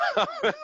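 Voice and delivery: short high burst of laughter. Real, not acted. Laughing to Gare about his tough-love line, high burst of laughter. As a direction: laugh at his own bluntness.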